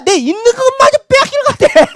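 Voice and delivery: high-pitched cry tipping into laughter; laughing exclamation. Real, not acted. Preacher on fearing to lose possessions, laughing. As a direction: burst out laughing as you finish.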